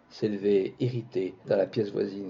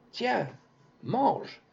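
high sudden outcry; child's cry; 10 semitones higher than usual. Acted. Little girl shouting to the beggar as she throws the bowl. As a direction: fling the words out like a child. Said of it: "L'enfant cria".

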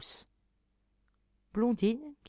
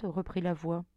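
ordinary delivery, nothing marked

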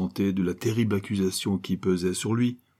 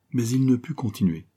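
plain reading voice

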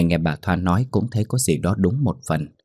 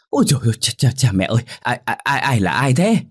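much higher, stammering fright; startled yelp; 5 semitones higher, faster than usual. A narrator's reading. Old servant startled by a tap on her shoulder, high frightened stammer. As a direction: jump out of your skin and cry out. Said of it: "giật bắn mình hét lớn".

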